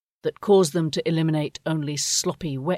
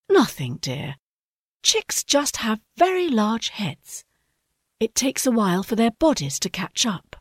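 soothing mother; 7 semitones higher than usual. A narrator's reading.

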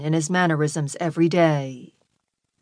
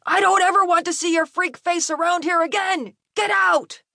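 put-on high, hateful scream from a drunk father; dad raging at the top of his range; furious screaming; 14 semitones higher than usual. A narrator's reading. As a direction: drive him out with rage. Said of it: "his dad was screaming at him".

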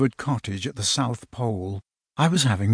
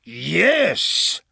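drawn-out yes, swooping up high; big, stretched wizard voice; giant wizard; 5 semitones higher than usual. Acted. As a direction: answer as a pondering giant. Giant wizard's slow reply.

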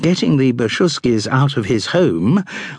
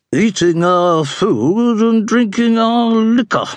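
high, slow, peevish matron; 12 semitones higher, slower than usual. A narrator's reading. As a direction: complain like a put-out duchess. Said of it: "said the Dowager Duchess peevishly".

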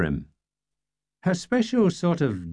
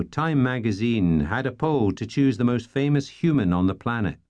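plain reading voice

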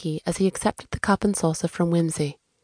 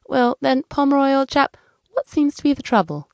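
put-on high, chummy old-chap voice; jovial would-be detective; 7 semitones higher, 3 dB louder than usual. Amateur detective inviting the client's trouble, chummy. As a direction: open the interview brightly, pitched well up. Whimsy opens the interview.

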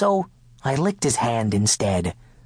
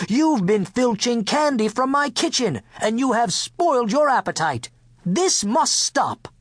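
higher-pitched, mildly indignant telling-off; firm scolding; 8 semitones higher than usual. A narrator's reading. Cook confronting the king over stolen candy, scolding. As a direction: scold firmly, slowing for the final order.

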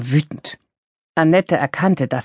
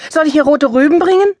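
louder, higher, fast mocking retort; sarcastic snapping; 11 semitones higher, 5 dB louder, faster than usual. A narrator's reading. Udo mocks Monika's advice, loud and fast. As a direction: throw the sarcasm back at her quickly.

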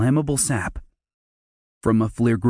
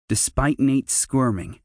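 ordinary delivery, nothing marked

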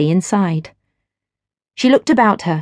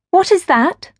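much higher, wondering; curious young wife; 10 semitones higher than usual. A narrator's reading. Gwen asks the stranger about the scent, wondering. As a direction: ask in delight at the scent.

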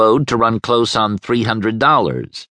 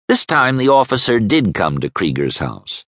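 plain reading voice

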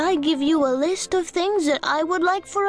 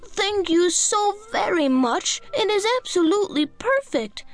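put-on delighted little girl; 8 semitones higher than usual. A narrator's reading. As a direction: gush with thanks like a child. Jane thanks the fairy.

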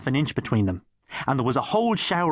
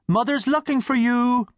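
high, louder call across the street; shout; 11 semitones higher, 3 dB louder than usual. Acted. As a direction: call out to your brother. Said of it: "his brother shouted".

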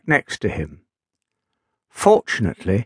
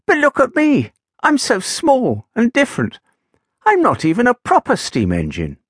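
high, fretful little engine; 10 semitones higher than usual. Acted. Little engine complaining to Gideon, high and fretful. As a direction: lift high and sound small and worried. Little Titch voiced, upset.

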